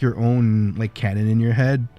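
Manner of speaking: ordinary talk, nothing marked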